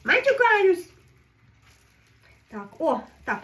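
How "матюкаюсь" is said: loud, high, proud answer; defiant admission. Real, not acted. Answering the viewer who asked about swearing, defiant. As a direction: own it cheerfully.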